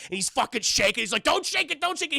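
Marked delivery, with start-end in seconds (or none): 1.2-2.2 high, urgent imitation warning; urgent acted plea to stop; mock alarm. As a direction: pitch jumps up in hammed-up panic. Dad panics as gift shaken.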